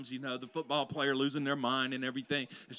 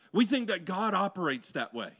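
preaching; 5 semitones higher, 8 dB louder than usual. Acted. Preacher telling the church how they picture God, loud. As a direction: lean into each phrase.

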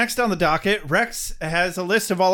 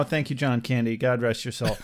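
usual speaking voice

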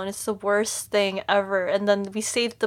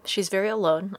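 usual speaking voice

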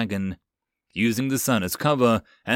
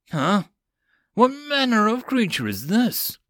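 astonished; 11 semitones higher than usual. A narrator's reading. Warrior astonished by creature.

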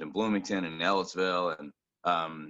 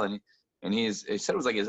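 usual speaking voice

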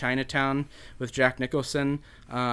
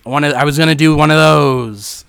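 Jack Nicholson; 3 semitones higher, 10 dB louder than usual. Acted. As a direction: belt it out, stretching the last word. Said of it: "And Jack's like".

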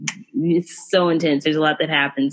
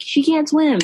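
much higher-pitched quoted panic; imitated freak-out; 10 semitones higher than usual. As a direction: act out someone freaking out, pitched up. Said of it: "in the beginning he's freaking out".

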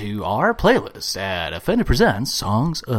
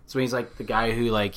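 ordinary talk, nothing marked

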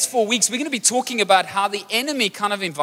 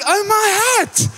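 high, slow mock dismay; exasperated exclaim; 12 semitones higher, slower than usual. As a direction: cry out in disbelief at the lamb. Lamb back in water again.

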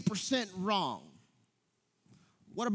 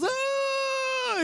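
put-on very high toddler yelp of pain; toddler's cry. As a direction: yelp high like a two-year-old hitting a wall. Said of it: "Craig goes".

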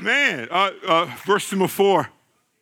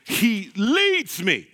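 slow emphatic; much slower than usual. Acted. Preacher savoring scripture.

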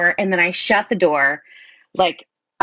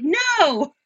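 much higher, forlorn no; high helpless no; 10 semitones higher than usual. Retold or imitated. Caller answering the dispatcher, high and forlorn. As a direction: squeak a tiny no.

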